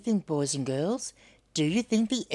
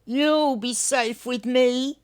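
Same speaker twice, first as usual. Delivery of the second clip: higher, louder, slow gentle promise; reassuring Hunwick; 7 semitones higher, 5 dB louder, slower than usual. Acted. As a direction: soothe the egg like a child. Hunwick comforts the egg.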